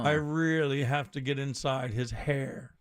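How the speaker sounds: lightly put-on overly artsy actor; a slow, self-important thespian drawn out toward the end; hint of pretentious Shakespearean actor; Ben Kingsley. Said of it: "like, overly artsy, like, overly all that".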